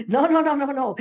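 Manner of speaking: a preacher's insistent refusal; emphatic no. Real, not acted. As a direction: slam the door on it with each no. Preacher rejecting false conversion.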